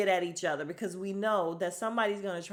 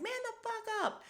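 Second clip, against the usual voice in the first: mock telling-off. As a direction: imitate an exasperated woman shutting someone up. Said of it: "she's literally telling him to shut up".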